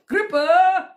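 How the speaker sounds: high, loud name burst out with laughter; giggly shout-out; laughing greeting. Real, not acted. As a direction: greet a friend through giggles.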